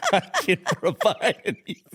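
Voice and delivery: words squeezed out through real laughter; can barely get it out for giggling; laughing. Real, not acted. Offering listeners an email to send corrections, laughing through it. Cracking up mid-joke.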